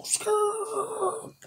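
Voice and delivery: high, long skirt sound; tire screech. Retold or imitated. Describing the donut in the street, screeching tires. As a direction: let the tires squeal.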